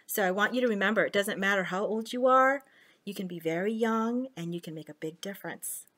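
plain reading voice

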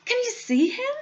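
put-on high, amazed child's voice; crying out. A narrator's reading. Child showing grandpa the little man, crying out. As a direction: insist excitedly, pitch jumping up. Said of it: "cried Charlie".

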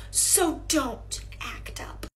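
normal talking voice

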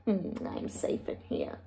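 low, very soft murmur; whispered aside. Acted. Third pig safe indoors, whispering to himself. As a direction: murmur it to yourself under the breath.

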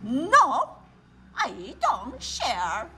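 slow, stubborn bear refusal; refusing bear. A narrator's reading. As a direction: drag out the refusal. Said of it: "said the bear".

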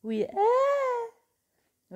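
cri de joie très aigu et très fort. Real, not acted. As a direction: hurle ta joie, voix qui monte en flèche. (cri aigu de joie)

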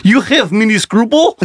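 lightly done bad Russian accent, high and laughing; a hint of a terrible Russian accent. Boyfriend playing Lenin in a bad Russian accent. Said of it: "in the worst Russian accent".

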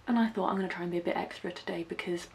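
ordinary talk

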